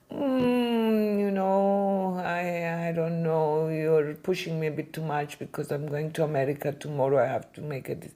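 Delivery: put-on old gentleman reluctant to sell; hesitant old man. Voicing the elderly man.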